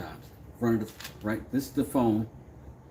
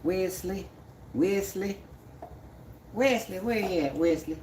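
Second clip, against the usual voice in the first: high, singsong mimicry of someone calling; mocking name-caller; 9 semitones higher than usual. People calling him Wesley, high mocking call. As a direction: imitate an annoying caller in a high put-on voice. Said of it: "when people call me Wesley".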